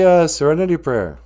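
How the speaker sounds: normal talking voice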